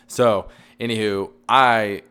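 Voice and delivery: loud, over-projected restart; yelling. Real, not acted. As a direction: push the volume up unthinkingly. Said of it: "Sorry, I'm yelling".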